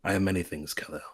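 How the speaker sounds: low, quiet villain proclamation with pauses; Darkseid. Retold or imitated. Tyrant answering his enemy at the end, low and solemn. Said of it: "He's like".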